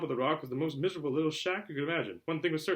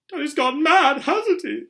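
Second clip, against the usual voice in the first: Dudley asking; 14 dB louder, slower than usual. A narrator's reading. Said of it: "Dudley asked Aunt Petunia dully".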